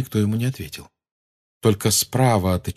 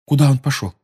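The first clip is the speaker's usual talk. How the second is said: urgent question; 5 semitones higher, 4 dB louder, faster than usual. A narrator's reading. Anxious questioning.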